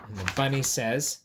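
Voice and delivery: ordinary delivery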